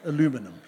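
"Aluminum" the American way, stress on LU; American pronunciation. Shown as a demonstration. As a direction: say "Aluminum" with four syllables, stressing the second. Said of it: "If, if you're American".

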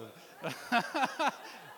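silent wheezing laugh at his own pun; laughing at his own joke; breathy laugh. Real, not acted. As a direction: let out a breathless chuckle.

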